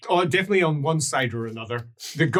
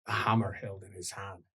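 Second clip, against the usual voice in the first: game master scene setting; 7 semitones lower, 11 dB quieter than usual. Acted. Game master describes Leopold's vision of the Emperor, solemn narration. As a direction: unfold the vision phrase by phrase, low and measured.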